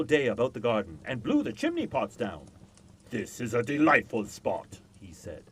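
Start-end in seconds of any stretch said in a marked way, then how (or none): 3.1-4.8 louder, booming north wind; lightly voiced north wind, pleased; a touch of the blustery north wind. A narrator's reading. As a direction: boom it like the roaring north wind.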